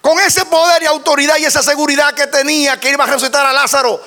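high, strained pulpit shout; impassioned high-pitched sermon delivery; full-force preaching. Real, not acted. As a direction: cry out each phrase with mounting fervour.

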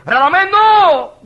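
high, loud, drawn-out cry; urgent stopping shout. Acted. God stopping the pastor from giving up, urgent stopping shout. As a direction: halt him with one long shout. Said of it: "oí una voz que me dijo".

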